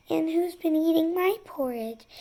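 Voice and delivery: a gentle middle voice for mama bear. A narrator's reading. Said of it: "In her soft middle voice".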